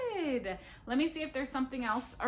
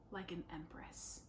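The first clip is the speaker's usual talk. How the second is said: whisper; 5 semitones lower, 12 dB quieter than usual. Pretend. Whispered joking ending.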